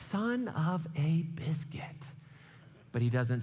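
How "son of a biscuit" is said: slow, hushed imitation of a curse; drawn-out mock swearing. Frustrated boy alone in bathroom, slow mock curse. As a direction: stretch out each word of the oath.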